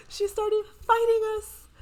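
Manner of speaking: high excited report. Real, not acted. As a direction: blurt it out still reeling. Still shocked by the fight.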